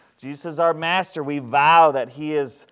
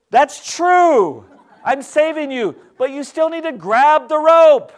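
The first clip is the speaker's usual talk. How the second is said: high, loud acted pleading from above; insistent calling; 9 semitones higher, 7 dB louder than usual. Rescuer argues with person refusing the rope, insistent high call.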